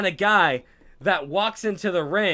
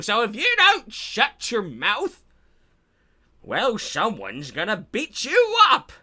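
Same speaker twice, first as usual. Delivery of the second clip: slow, creaky geezer voice, higher; hammed-up old-timer lecture; old man; 5 semitones higher, slower than usual. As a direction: croak it out like an ancient wrestler. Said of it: "He's, like, eight thousand years old".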